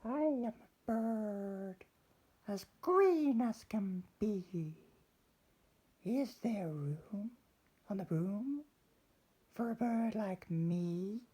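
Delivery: very high, slow bird voice; a prim little bird asking politely. Acted. Bird asking the witch for room, high and slow. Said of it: "then said".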